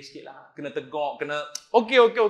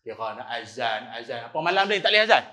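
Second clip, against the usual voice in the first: ordinary talk, nothing marked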